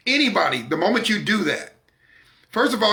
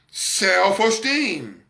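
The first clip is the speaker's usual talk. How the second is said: slow emphasis; much slower than usual. Shown as a demonstration.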